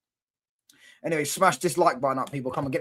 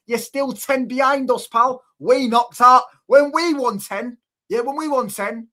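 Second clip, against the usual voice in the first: heated banter; 8 semitones higher, 6 dB louder, slower than usual. Real, not acted.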